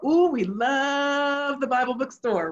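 slow, exaggerated delighted exclamation; gushing delight. Real, not acted. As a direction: stretch out "love" with relish. Gushing over favourite childhood place.

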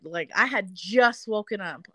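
normal talking voice

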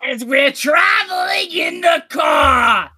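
very loud, slow, high impression voice; Gilbert Godfrey. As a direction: blare out the narration in a drawn-out comic screech. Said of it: "It's just very loud".

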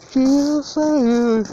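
high drawn-out voice. Pretend. Odd high stretched voice.

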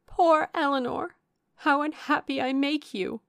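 high, loud, pitying lament; pitying distress. A narrator's reading. Younger sister grateful to Eleanor for her kindness, pitying distress. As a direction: cry out with pity for your sister. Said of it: "able to express some sense of her kindness".

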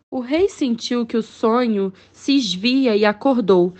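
usual speaking voice

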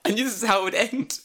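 high, loud cry of defeat; mock despair. Teacher busted by tiny cop, loud comic despair. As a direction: wail it like a caught culprit. Acting out being arrested.

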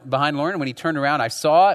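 ordinary talk

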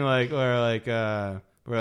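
ordinary delivery

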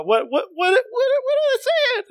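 very high, rising falsetto prompt; mock panic. Host cueing co-host to voice fleeing characters, mock-panicked. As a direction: squeal the question in mock panic.

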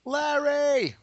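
high loud shout of the name. Pretend. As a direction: yell the name across the room. Calling out the name again.

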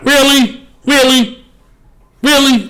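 high-pitched, falling "really?" in disbelief; incredulous. Real, not acted. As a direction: question it in high, repeated disbelief. Incredulous at Manny's criticism.

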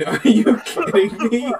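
normal talking voice

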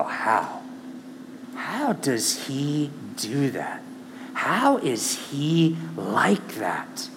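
slow, soft wondering whisper; awed hush. Retold or imitated. Believer marveling at Jesus, hushed and slow. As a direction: ask it in quiet amazement. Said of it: "And you start to go".

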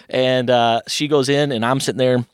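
normal talking voice

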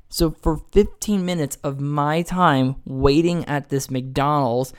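normal talking voice

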